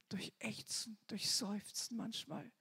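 soft, breathy whisper. Real, not acted. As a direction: let the words sink to a whisper. Describing groaning prayer.

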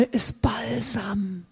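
slow reverent whisper; hushed adoring whisper. Real, not acted. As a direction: breathe it like a secret. Tender words about Jesus.

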